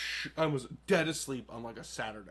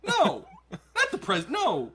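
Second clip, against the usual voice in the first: laughing mom; 9 semitones higher, 4 dB louder, slower than usual. Retold or imitated. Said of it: "And she's like".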